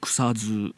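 草津 as kusa-ZU, slowly drawn out at the end; voiced ending. Shown as a demonstration. Host repeats the old name for the guest, 草津 voiced as kusazu. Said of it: "濁ってたらしいよ".

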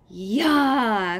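loud mock battle cry; playful shout of attack. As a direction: yell as you lunge. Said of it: "Clay shouted".

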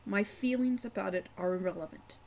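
ordinary delivery

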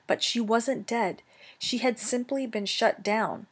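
ordinary delivery

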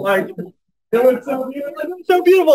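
gushing. Real, not acted. Gushing about the ceremony.

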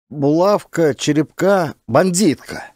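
high, falling on each name as a statement; naming bandits, straining. A narrator's reading. Boy names the captured bandits to the officer, straining. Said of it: "С натугой выдохнул пацан".

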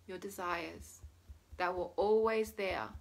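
ordinary talk, nothing marked

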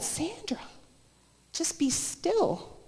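very soft and slow, retold as gentle instruction; hushed calming voice. The Lord calming a panicking woman, hushed and slow. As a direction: drop to a hush and draw it out to settle someone.

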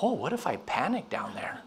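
hushed, mildly worried retelling; anxious. Retold or imitated. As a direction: retell a private worry under the breath. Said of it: "I started getting anxious".

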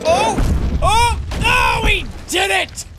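celebrating shout; triumphant shout. Real, not acted. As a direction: yell with joy at the success. Creature finally makes the jump.

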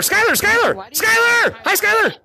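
high shout across the room; calling out. Real, not acted. Calling out to player Skylar.

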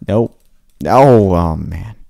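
ordinary talk

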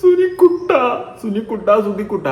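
high, hammed-up tearful call; mock wailing. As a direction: imitate someone crying out a pet name. Mocks the tearful calling. (കളിയാക്കി കരഞ്ഞു വിളിക്കുന്നു)

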